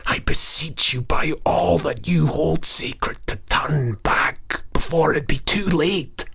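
high, slow, tearful whisper, begging; an old goblin beseeching him to turn back; pleading goblin whisper. Acted. Goblin begging the Earl to turn back, whispered tearful plea. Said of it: "and whispered to him also".

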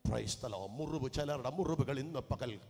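usual speaking voice

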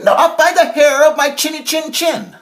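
high, loud piglet refusal; defiant little pig. Acted. Harold refuses the wolf from inside, high and loud. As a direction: squeal it back defiantly. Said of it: "Herman said".

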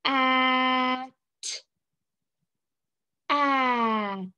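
"at" with a long drawn vowel, then T; slowly blended "at"; sounding out. Shown as a demonstration. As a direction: stretch the vowel in "at" and close on a separate t. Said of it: "put these two sounds together".

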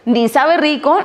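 ordinary delivery

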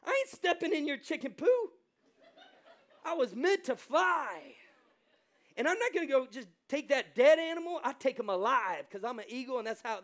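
loud, high, cocky eagle voice; a hammed-up eagle bragging that it won't touch the ground; proud eagle. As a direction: strut it like a bird above it all. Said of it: "the eagle's like".

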